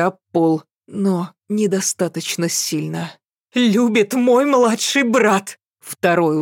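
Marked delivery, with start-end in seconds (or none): 3.5-5.6 slow high defiant cry; defiant shout. A narrator's reading. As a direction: hammer each word out. Girl defying the demon.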